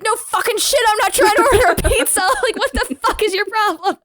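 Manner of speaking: high-pitched imitation of an exasperated yell; comic yelling back at the dispatcher; mock yelling. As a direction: retell his outburst in a high, indignant voice. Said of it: "like, yelled to people".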